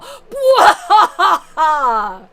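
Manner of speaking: loud, high evil cackle; villain laugh. Pretend. Evil laugh after boast.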